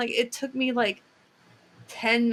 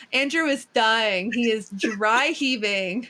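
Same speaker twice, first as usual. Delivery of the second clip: normal talking voice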